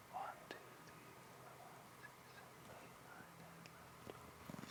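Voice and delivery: counting softly under the breath, voice unvoiced; whispered counting. Real, not acted. Silent counting on stage.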